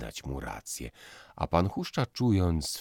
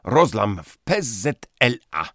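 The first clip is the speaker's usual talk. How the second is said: loud, alarmed announcement; dramatic shout; 9 semitones higher, 11 dB louder than usual. Acted. Fiancé bursting in from the doorway, shouting the news. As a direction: cry it like news of an earthquake. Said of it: "zawołał od progu takim głosem, jakby zwiastował trzęsienie ziemi".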